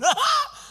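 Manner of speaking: high burst of laughter. Real, not acted. Laugh alone.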